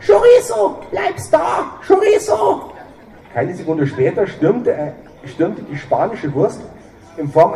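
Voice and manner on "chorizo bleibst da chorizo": very high, loud shrieking woman's voice; shrill scolding woman. Acted. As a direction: screech after the runaway dog. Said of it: "eine keifende Frauenstimme".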